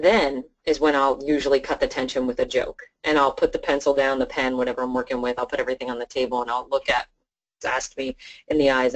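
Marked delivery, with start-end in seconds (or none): none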